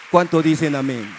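calling the church to answer. Real, not acted. Preacher repeats call, louder.

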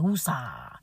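low, soft self-soothing exhale; calming woosah. Pretend. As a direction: breathe the word out to calm yourself down. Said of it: "I'm gonna be like".

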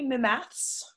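mock British phrasing; British accent. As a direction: say it as the Brits do, lifted at the end. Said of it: "As the Brits say".

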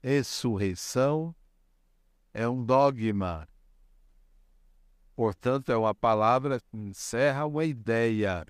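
ordinary delivery

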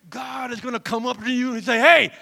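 loud, driving preacher delivery; fired-up preaching. Acted. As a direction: preach it with rising force. Preaches at full force.

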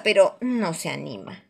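plain reading voice

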